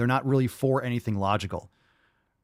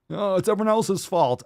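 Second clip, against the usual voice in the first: higher, whiny imitation of a complainer; mock whining; 6 semitones higher than usual. Reactor mocking those who blame others, mock whiny. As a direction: parody someone playing the victim.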